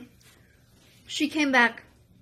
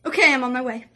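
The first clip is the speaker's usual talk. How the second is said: urgent reply; 6 dB louder, faster than usual. Retold or imitated. Leader's urgent answer.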